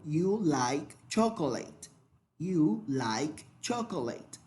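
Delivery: slow, clear English example; slow model sentence. Shown as a demonstration. Teacher modelling an English sentence for the class, slowly. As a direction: spell it out slowly for learners. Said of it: "yo digo".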